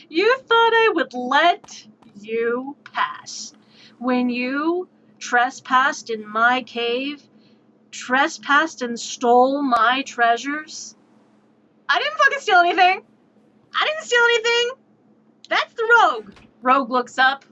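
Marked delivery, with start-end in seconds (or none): 0.1-10.9 slow, menacing dragon voice; slow, threatening dragon. Acted. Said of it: "the dragon looks at us and goes". 11.9-16.3 indignant protest. Acted. Defending himself to the dragon.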